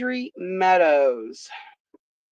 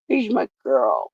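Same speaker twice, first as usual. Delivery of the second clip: ordinary talk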